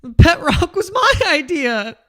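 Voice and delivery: high, loud, hammed-up exasperated rant; jealous would-be inventor. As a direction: whine like someone whose idea was stolen. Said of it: "you were like".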